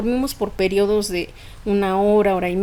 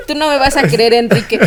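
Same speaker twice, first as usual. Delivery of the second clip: said through leftover laughter, louder; laughing relief; 7 dB louder than usual. Real, not acted. Telling the host about her son, still laughing.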